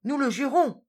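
high, loud chorus of birds; shrill little bird voices crying out together; a flock of birds swearing loudly. A narrator's reading. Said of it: "crièrent les oiseaux".

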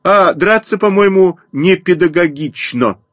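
put-on high earnest sparrow boy. A narrator's reading. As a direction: protest primly in a high voice. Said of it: "и добавил".

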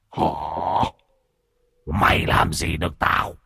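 hoarse, growled challenge; taunting possessed voice. A narrator's reading. Possessed man defies the master, low raspy taunt. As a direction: rasp it out low, daring him.

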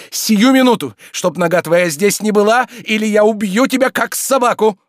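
very high, loud threat; enraged shouting. Acted. Oblomov threatens to kill the scoundrel, enraged shouting. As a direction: shout in uncontrolled fury. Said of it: "трясясь от ярости".

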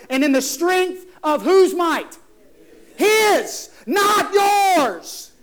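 high, shouted sermon delivery; full-force preaching. Real, not acted. Preacher driving home whose power it is, full-force preaching. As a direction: thunder it out to the congregation, stretching key words.

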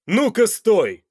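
loud, high, sudden bark; barked order. A narrator's reading. Man halts a woman leaving the room, barking. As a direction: stop her in her tracks. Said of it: "рявкнул Данте в её сторону".

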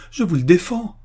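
high-pitched, emphatic prohibition; forbidding cry. A narrator's reading. Sister forbidding officer to denounce her brother, crying out. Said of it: "crie Lucienne avec un geste de recul".

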